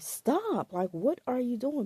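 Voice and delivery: serious stop. Retold or imitated. Getting serious with the boyfriend pawing at her, a high loud firm command. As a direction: snap him out of it with a sharp serious command. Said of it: "I got super, super, uh, serious".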